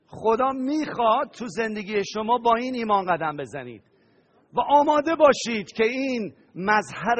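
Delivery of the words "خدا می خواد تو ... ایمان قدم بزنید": normal talking voice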